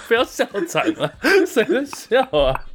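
边笑边说，忍不住笑; cracking up mid-sentence; laughing while talking. Real, not acted. (笑着说话)

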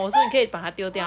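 ordinary delivery

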